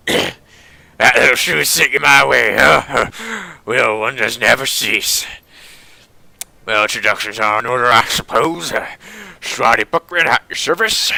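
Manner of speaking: hammed-up old German tinkerer introducing himself; gruff well-meaning German. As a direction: put on a gruff, accented old man. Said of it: "He speaks with a gruff but well-meaning tone".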